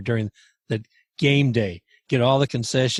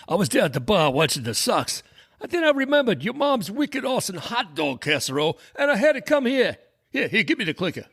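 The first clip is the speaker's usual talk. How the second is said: put-on high voice of a bar regular; Boston sports fan; 6 semitones higher than usual. Sox fan explaining himself to Tommy, animated Boston buddy. Acted comic skit before the show.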